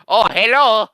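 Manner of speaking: loud, high cartoon Italian accent; hammed-up thick Italian accent; Luigi. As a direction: burst out in a big Italian accent. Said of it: "he just kinda sounds like Luigi".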